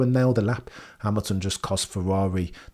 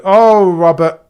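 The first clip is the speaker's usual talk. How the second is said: big exclaim; 8 semitones higher, 12 dB louder, slower than usual. Real, not acted. Exclamation at viewer comment.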